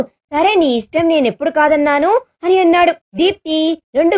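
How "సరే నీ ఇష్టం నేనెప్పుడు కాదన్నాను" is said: usual speaking voice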